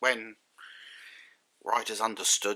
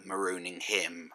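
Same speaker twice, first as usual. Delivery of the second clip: ordinary talk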